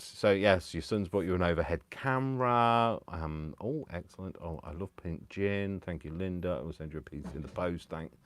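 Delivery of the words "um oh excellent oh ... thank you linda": quiet excellent. Real, not acted. Reading comments quietly.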